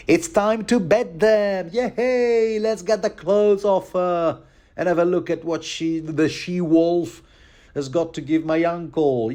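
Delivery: bratty Joffrey. Retold or imitated. Mocking the boy king calling for the bedding, high and gleeful. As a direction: squeal it like a spoiled boy king. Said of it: "Joffrey doubles down".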